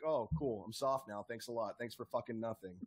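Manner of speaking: low, soft, deadpan letdown; sarcastic thanks, all air let out; deflated sarcasm. Pretend. Deflated reaction to bad trailer.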